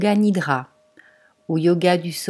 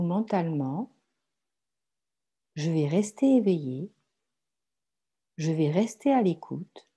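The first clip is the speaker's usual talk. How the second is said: guided meditation; 4 dB quieter than usual. Acted. Guides a yoga nidra meditation. (méditation guidée, voix douce et posée)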